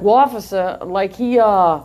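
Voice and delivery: imitated New York witness accent. Witnesses laughing as they tell the officer, New York accent. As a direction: tell it like a New Yorker, dropping the r. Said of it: "in their New York accents".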